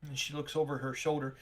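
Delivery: ordinary talk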